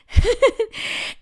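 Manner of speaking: loud, high laugh bursting out; high burst of laughter. Real, not acted. Telling viewers about her brother, high loud laugh. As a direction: crack up at the memory.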